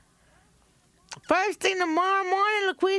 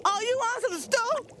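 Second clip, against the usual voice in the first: rising higher, ending in a shout; sarcastic rage; 8 semitones higher than usual. Acted. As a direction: offer the stew as a threat. Wife turns on husband with stew.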